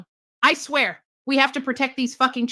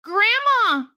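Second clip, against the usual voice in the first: put-on much higher wide-eyed child voice; Red asking the wolf in grandma's bed, high and innocent; little girl voice; 11 semitones higher, slower than usual. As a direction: ask it like a scared little girl. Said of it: "Little Red said".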